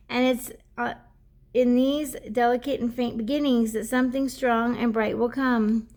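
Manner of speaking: card reading to viewers; oracle card reading. Acted. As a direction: read the guidebook aloud like a gentle message. Reads the oracle card text.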